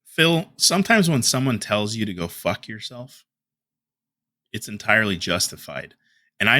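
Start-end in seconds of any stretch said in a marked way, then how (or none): none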